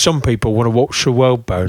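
put-on Cockney accent at normal pitch; measured Cockney movie line; Michael Caine. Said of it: "You gotta have a trigger".

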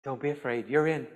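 soft, low and comforting; gentle reassurance. Acted. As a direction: reassure them quietly. Jesus' reassurance, first said gently.

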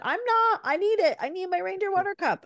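put-on high, whiny little-kid protest; insistent toddler. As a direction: imitate a small child pleading hard. Mother voices child insisting.

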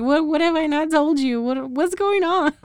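imitating a confused, betrayed reply; mock bewildered protest. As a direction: play him as hurt and baffled.

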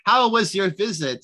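high, slow and overly polite butler voice; high, slow butler impression. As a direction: sing it out sweetly like a fawning butler. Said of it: "He's like".

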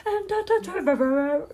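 mock stadium echo, stuttered name; stadium announcer. Second go at announcing the code name, mock announcer. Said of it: "Kinda sounds like the stadium".

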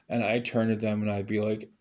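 ordinary talk, nothing marked

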